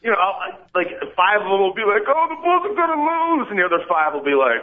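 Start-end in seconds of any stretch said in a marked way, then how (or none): 2.1-3.5 high, whiny worried fan; panicky fan. Retold or imitated. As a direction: wail it like a nervous fan. Quoting panicky fans.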